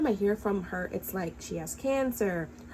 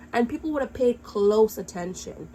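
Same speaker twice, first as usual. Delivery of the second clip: ordinary delivery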